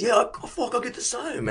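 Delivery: a breathy high admission as Cody; imitating Cody. Imitating Cody admitting anxiety, high soft voice. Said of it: "he goes".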